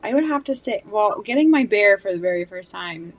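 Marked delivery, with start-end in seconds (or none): none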